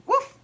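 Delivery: short, high, loud imitated bark; dog woof. A narrator's reading. Ebb to busy Bird, high woof. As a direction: yap out a quick woof. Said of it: "said Ebb".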